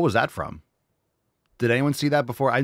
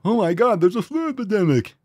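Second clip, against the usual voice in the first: high, louder mocking alarm; mock panic; 9 semitones higher, 5 dB louder than usual. Mocking people who panic over flu tests, high mock alarm. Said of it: "if you tested every last person".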